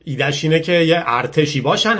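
plain reading voice

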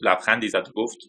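ordinary delivery, nothing marked

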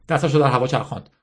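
ordinary delivery, nothing marked

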